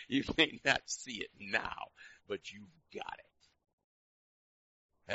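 slow, drawn-out, loud then easing; deliberate slow pulpit build; slow preaching emphasis. Real, not acted. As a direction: stretch every word to the church.

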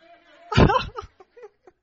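loud, high burst of laughter; big laugh. Real, not acted. Preacher laughing at his own greed, loud real laugh. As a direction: laugh out at yourself.

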